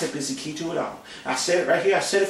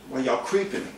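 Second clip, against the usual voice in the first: usual speaking voice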